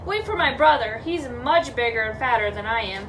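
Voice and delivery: mildly scared storybook goat; pleading, frightened goat. Acted. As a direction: beg timidly, then settle into bargaining. Middle goat begs for its life.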